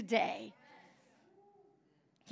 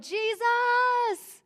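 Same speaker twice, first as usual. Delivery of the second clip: very high, held-out cry; raised cry; 10 semitones higher than usual. Acted. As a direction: cry out with longing to be healed. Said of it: "to raise a cry of joy and pain".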